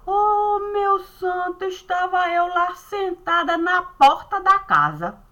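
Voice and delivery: high-pitched cry of "meu santo"; exasperated exclamation. Retold or imitated. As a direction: throw up your hands at the saint. Exclaiming to a saint. (exclamação exasperada, aguda)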